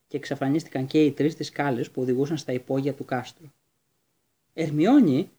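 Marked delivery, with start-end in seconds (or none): 4.5-5.3 Ερμιόνη said louder, in amazed admiration; an impressed, wide-eyed Ερμιόνη. A narrator's reading. Ron repeating her name after the slap, amazed and impressed. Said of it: "επανέλαβε ο Ρον έκπληκτος και εντυπωσιασμένος".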